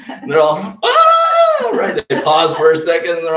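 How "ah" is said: very high loud mock baby shriek; baby scream. Preacher acts out the screaming baby, shrill yell. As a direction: shriek like a baby hit by cold water.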